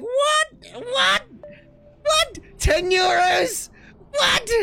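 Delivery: very high, squeaked disbelief; mock amazement. Waitress amazed at a ten euro tip, high mock-amazed squeal. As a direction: squeak it out, stunned by the windfall.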